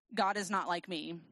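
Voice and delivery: usual speaking voice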